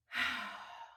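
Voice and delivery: long breathy sigh out; heavy sigh. Real, not acted. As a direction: let out a heavy exhale. Reflective pause before a heavy point.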